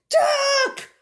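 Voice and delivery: high, loud yell; shouted warning. Acted. Minnie warns Moo of a flying pig, shouting high. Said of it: "Minnie shouted".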